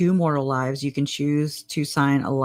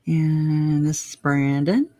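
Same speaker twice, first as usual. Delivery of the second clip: ordinary talk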